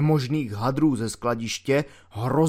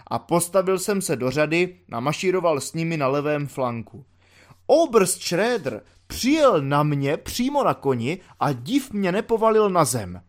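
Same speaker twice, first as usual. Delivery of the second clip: ordinary delivery, nothing marked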